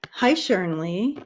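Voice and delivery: usual speaking voice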